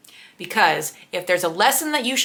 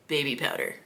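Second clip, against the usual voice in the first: low flat mumble; deadpan low guy voice; 5 semitones lower, 9 dB quieter than usual. Retold or imitated. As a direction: drop it low and dry. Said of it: "And the guy goes".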